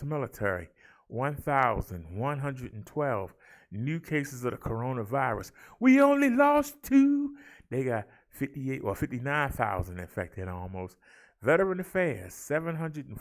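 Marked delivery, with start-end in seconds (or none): 5.8-7.4 loud, much higher, celebrating; high, loud triumphant call; showy announcement. Acted. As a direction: call it out like good news. Stat read with showy emphasis.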